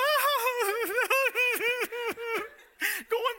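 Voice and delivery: loud high laugh bursting out; big laugh. Real, not acted. Preacher laughing at his mom's worry. Laughing at the wild refuel story.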